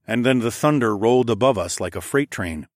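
usual speaking voice

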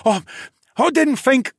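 high, loud fearful excuse; pleading again. A narrator's reading. Guard tries again to plead, high and fearful. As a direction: scramble for an excuse. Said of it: "he tried again".

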